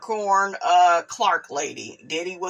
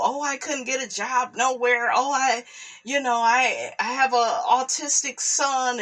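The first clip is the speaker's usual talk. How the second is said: higher-pitched imitation of someone pleading hardship; mock excuse-making; 5 semitones higher than usual. Critic mimicking a woman's excuses for not working, mock pleading. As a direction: mimic her excuses in a raised voice.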